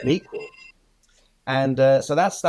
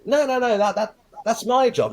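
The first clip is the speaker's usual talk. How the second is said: protesting; 10 semitones higher than usual. Retold or imitated. Retold protest.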